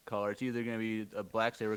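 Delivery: ordinary delivery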